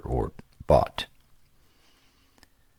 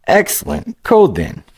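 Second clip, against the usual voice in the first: put-on lightly raised, eager young gamer voice; a casual young player, a bit higher than the narration; upbeat young adventurer, higher pitched; young hero Greylick; 13 semitones higher, 3 dB louder than usual.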